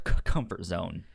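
ordinary delivery

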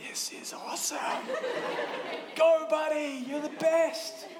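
excited cheer, voice jumping up high; cheering dad. Retold or imitated. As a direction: cheer on your kid from the audience. Dad cheering son at concert.

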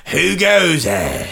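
slow, high, drawn-out ghostly challenge; spooky graveyard voice. Acted. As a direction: call it out like a haunting ghost. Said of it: "That's the voice I was doing".

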